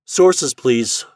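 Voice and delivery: crisp and direct, giving orders to a machine; Shandor's voice. A narrator's reading. Shandor asks the library index for files, brisk.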